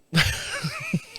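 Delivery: squeaky giggle; high burst of laughter. Real, not acted. Laughing mid sentence.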